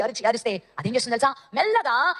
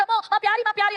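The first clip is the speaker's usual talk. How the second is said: high, anguished cry, lightly hammed-up; acted parental alarm; 9 semitones higher than usual. Parents come running for their child Pyari, acted alarm. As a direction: wail high for a missing child.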